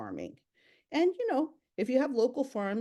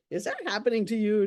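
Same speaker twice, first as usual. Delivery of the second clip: ordinary talk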